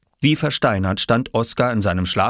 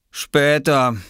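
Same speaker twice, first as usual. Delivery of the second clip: sleepy, higher-pitched put-off; yawning; 7 semitones higher, 4 dB louder than usual. Acted. Viktor still in bed when Oskar calls, yawning. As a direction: say it through a yawn. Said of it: "sagte Viktor und gähnte".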